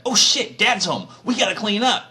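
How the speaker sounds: high, loud panicked yelp; caught red-handed panic. Acted. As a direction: squeal like a teen caught partying. Aaron caught by returning Moses.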